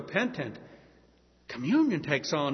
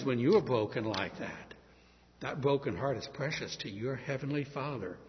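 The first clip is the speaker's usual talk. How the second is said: usual speaking voice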